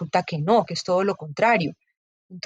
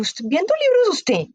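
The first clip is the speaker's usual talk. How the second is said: ironic mocking; 9 semitones higher, slower than usual. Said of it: "en un tono irónico, burlón".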